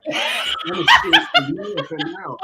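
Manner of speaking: very high, slow, laughing holler; drawn-out, high shouted impression of delight; gleeful mock shout. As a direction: imitate giddy joy at full pitch. Imitating the thrilled passenger.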